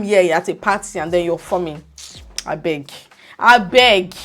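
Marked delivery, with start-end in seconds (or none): 2.5-2.9 low, soft "I beg"; quiet dismissal. Real, not acted. Dismissive aside, then repeated emphatically. 3.4-4.1 louder, forceful "I beg"; emphatic dismissal. Real, not acted. Same words repeated with force.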